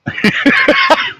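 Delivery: high squeaky giggle; a quick high laugh. Real, not acted. Vlogger laughing at his own show.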